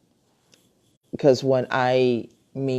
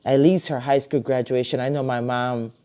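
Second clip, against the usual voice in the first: usual speaking voice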